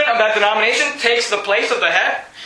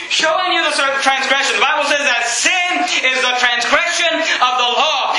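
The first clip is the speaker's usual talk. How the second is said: usual speaking voice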